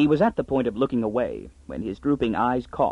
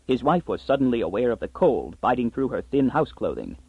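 plain reading voice

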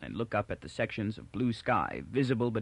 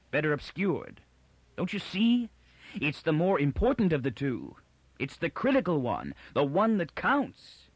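high-pitched, agitated argument; angry insistence; 5 semitones higher than usual. A narrator's reading. Miz defending the hidden second foundation, angry and insistent. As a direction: argue it angrily, pitch jumping up on key phrases. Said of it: "cried Miz angrily".

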